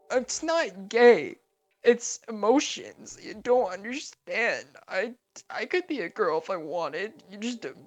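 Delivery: very high, loud, slow dramatic plea; emotional Kakashi. Acted. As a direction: wail it like a hurt diva. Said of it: "He's like".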